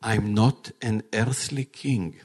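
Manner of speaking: calm, loving voice of Jesus speaking to his bride; gentle reassuring Jesus. Acted. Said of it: "what the king told Jairus?".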